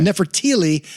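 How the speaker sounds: Nefertiti ending in "lee"; pronounced Nefertili. Shown as a demonstration. Co-host repeating the character's name, Nefertiti pronounced with an L. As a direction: say Nefertiti with an L for the last T. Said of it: "not Nefertiti".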